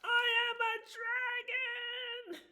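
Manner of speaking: high, loud furious dragon shout; dragon roar. Acted. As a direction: roar it out at full force. Dragon explodes.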